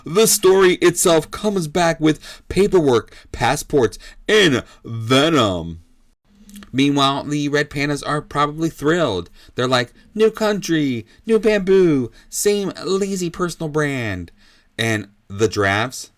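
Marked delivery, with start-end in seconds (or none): 0.0-5.8 emphatic ringside call; fight commentator. Acted. Commentator calling the counter from the news, dramatic play-by-play. 10.1-14.3 lightly voiced, higher-pitched animal quote; a touch of perky glee, easing at the end; thrilled red pandas. Host speaking for red pandas heading abroad, mildly thrilled.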